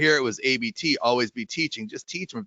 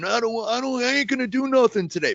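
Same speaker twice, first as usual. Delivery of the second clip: higher-pitched student refusing to work; imitated defiant kid; 8 semitones higher than usual. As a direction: imitate a kid digging their heels in, pitched up. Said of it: "kids are walking in all the time saying".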